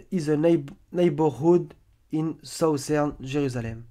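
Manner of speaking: halting English with a French accent. Real, not acted. French presenter reading a map description, French-accented English. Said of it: "j'ai un super accent anglais".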